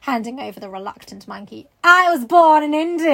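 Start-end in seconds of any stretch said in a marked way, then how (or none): 1.8-3.1 high, loud story-reading outburst; loud exclamation. A narrator's reading. Sarah tells the gentleman where she was born, loud exclamation. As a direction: blurt it out loudly.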